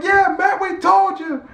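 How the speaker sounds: high mocking chorus of fans; crowd taunting. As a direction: tease Matt in a high put-on voice. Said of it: "everybody was like".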